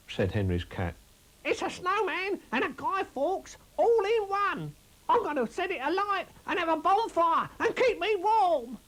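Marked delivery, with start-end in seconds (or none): 1.4-8.8 squeaky rabbit voice. Acted. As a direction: squeak it out like a small excited rabbit. Narrator voices Chris Rabbit.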